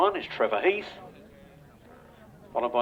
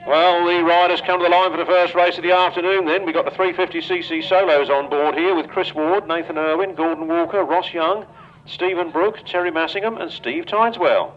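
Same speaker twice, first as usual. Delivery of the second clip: raised announcer voice calling the line-up over the track; race announcer; 5 semitones higher, 4 dB louder than usual. Real, not acted. Announcer presenting the riders to the crowd at the track, louder.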